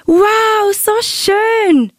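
begeistert. Real, not acted. Enkel freut sich über Schwangerschaft.

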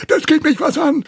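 put-on very high, loud old man's fury; furious outcry. A narrator's reading. Uncle Delbert snaps back at Steve, furious outcry. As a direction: burst out high and outraged. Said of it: "rief Onkel Delbert wütend und zitterte".

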